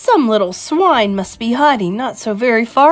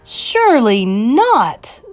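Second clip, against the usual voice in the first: slow, higher cry of disbelief; drawn-out incredulous shout; 5 semitones higher, 5 dB louder, much slower than usual. Acted. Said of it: "the others shouted".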